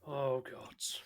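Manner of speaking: unvoiced groan of dread; whispered dread. Real, not acted. Player whispers in dismay at the voice. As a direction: breathe it out in dismay.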